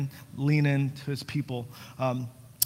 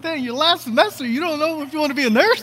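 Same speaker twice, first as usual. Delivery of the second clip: mock disbelief; 14 semitones higher, 8 dB louder, faster than usual. Mocking his own stunned reaction.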